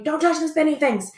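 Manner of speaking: playful teacher's scolding; mock classroom warning. Acts out warning to her class.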